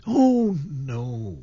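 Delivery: put-on a girl's quick startled protest; high-pitched surprised exclamation of denial; exclaiming. A narrator's reading. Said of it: "she exclaimed".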